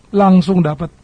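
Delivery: ordinary talk, nothing marked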